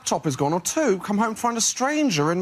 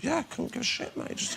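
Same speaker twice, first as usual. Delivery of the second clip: soft careless mutter; hushed reply; 3 semitones higher, 9 dB quieter than usual. Acted. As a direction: throw it away quietly. Quiet customer reply.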